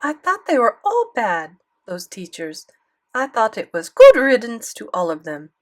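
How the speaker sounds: put-on emphatic grandmother with French accent; thick French accent. A narrator's reading. Grandmother dismissing his teachers, thick French accent. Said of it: "Grandmere had a thick French accent".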